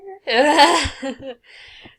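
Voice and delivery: loud laugh breaking out; burst of laughter. Real, not acted. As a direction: crack up laughing.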